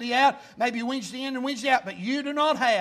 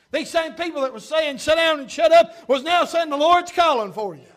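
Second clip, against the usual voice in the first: loud, driving pulpit delivery; preaching; 5 semitones higher, 10 dB louder than usual. Real, not acted. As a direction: punch key words, raised voice. Preaches at full force.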